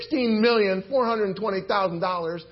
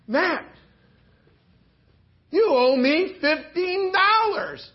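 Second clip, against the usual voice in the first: demanding creditor; 8 semitones higher, 4 dB louder, slower than usual. Acted. Servant demands payment.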